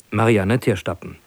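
usual speaking voice

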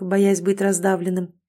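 plain reading voice

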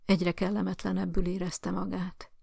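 usual speaking voice

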